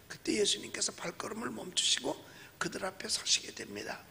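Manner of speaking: ordinary talk, nothing marked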